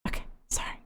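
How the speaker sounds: whispered self-hush; quick whispered apology. Real, not acted. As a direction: whisper to yourself to be quiet. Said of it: "shh, quiet".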